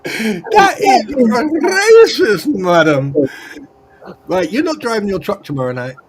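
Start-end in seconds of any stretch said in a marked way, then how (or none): 0.0-3.2 slow, high, drawn-out scolding; hammed-up prissy rebuke; prim mock reproach. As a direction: play the offended lady. Mock scolding after joke.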